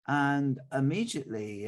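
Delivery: plain reading voice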